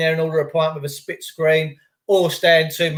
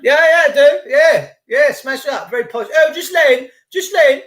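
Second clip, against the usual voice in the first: much higher, put-on posh voice; posh parents; 7 semitones higher, 4 dB louder than usual. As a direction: ham up a snooty upper-class parent. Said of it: "That's what posh people say".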